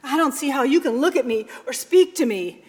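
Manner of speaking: loud, fast, agitated; ashamed son. Acted. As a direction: blurt out his shame.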